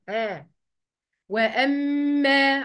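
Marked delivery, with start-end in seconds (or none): 1.3-2.6 "وأما" with a long hummed double m; ghunna. Shown as a demonstration. Teacher showing the doubled meem, "وأما" with held nasal hum. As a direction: hold the م of "وأما" in a slow hum. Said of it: "هغنها أهي".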